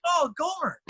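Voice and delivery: suddenly recognising a famous player; surprised recognition. Retold or imitated. Recognising the hockey star.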